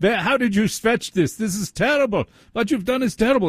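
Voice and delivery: high-pitched mock German scolding; yelling German accent. Surgeon yelling at the patient who stretched, fake German accent. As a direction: scold like a comedian faking an outraged German doctor. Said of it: "he's yelling at me with a German accent".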